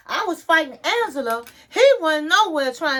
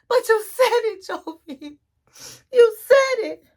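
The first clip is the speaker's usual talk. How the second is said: high teary accusation, half laughing; imitated crying; 8 semitones higher than usual. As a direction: cry it out at him, mock sob. Yara crying at Jovi.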